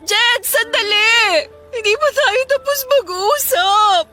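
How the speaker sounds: pleading call. Acted. Calls after leaving boyfriend.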